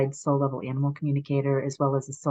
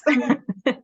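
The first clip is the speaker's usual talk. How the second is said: laughing; 6 semitones higher, 5 dB louder than usual. Real, not acted. Laughs after closing joke.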